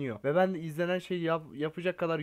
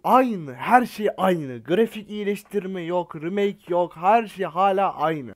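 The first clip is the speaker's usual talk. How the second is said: loud, slow, fed-up complaint; drawn-out grumbling at the game; exasperated rant; 7 dB louder, slower than usual. Real, not acted. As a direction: hammer home that nothing ever changes. Ranting that nothing changed.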